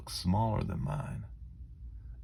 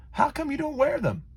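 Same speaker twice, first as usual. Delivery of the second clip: put-on much higher, curious kid voice; Antonio's voice; 4 dB louder than usual. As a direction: ask like a puzzled little boy.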